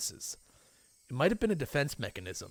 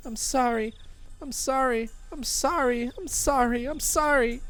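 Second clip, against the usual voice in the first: much higher, growing louder pleas; desperate apologies; 12 semitones higher, 6 dB louder than usual. Acted. Rena repeating sorry to Keiichi, high and pained. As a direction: plead over and over.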